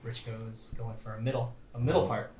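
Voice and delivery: hushed golf announcer voice; golf commentator. Acted. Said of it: "It's like golf commentary".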